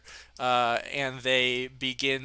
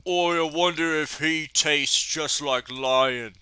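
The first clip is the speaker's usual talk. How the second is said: big bald brute sizing up his prey; big oaf; 2 semitones higher, 3 dB louder than usual. Acted. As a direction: play a hungry, menacing oaf. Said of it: "he looks to you and says".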